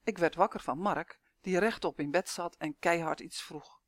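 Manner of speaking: ordinary delivery, nothing marked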